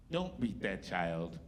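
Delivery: slow, low, grand voice of Esther Rolle; celebrity voice. Retold or imitated. Comic telling a crowd about the TV mom, slow and low. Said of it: "And it said".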